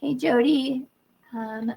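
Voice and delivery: normal talking voice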